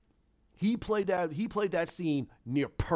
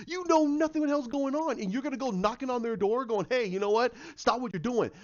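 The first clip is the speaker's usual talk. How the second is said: mock exasperated yell; 7 semitones higher, faster than usual. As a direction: yell at the character for being reckless. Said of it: "Even I went".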